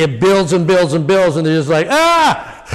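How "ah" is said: sharp high wail of pain; a sudden high anguished wail; anguished cry. Shown as a demonstration. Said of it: "it cries out".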